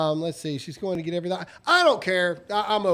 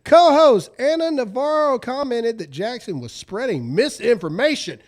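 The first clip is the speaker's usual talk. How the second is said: ordinary delivery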